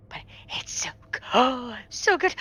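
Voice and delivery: high, soft, gasping whine; fangirl whimper. Acted. Fan denied a touch of the tail, whimpering excitedly. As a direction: whimper longingly with a gasp.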